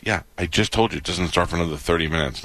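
ordinary talk

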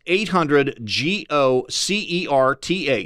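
letters spelled slowly one by one. Shown as a demonstration. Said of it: "certa with a C".

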